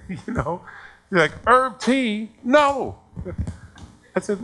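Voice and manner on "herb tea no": high, loud mock indignation; imitated angry parent. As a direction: refuse it like an offended parent. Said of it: "why are you so angry?".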